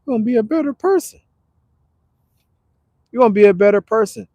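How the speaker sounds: fast, high, fired-up repeat; excited emphasis. Real, not acted. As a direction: hype the promise up quickly. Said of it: "repeats emphatically".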